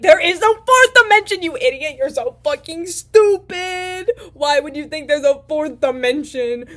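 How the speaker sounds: high, loud, furious yelling; heated rant. Retold or imitated. The sphere screams at the square for asking about a fourth dimension. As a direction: scream insults at him in exasperation. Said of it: "very heated".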